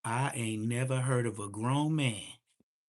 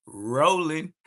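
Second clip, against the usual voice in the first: 1 laughing; 4 semitones higher, 9 dB louder than usual. Retold or imitated. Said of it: "L O L".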